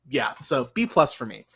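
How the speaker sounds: normal talking voice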